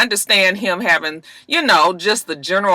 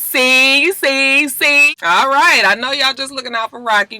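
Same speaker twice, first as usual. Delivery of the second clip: ordinary talk, nothing marked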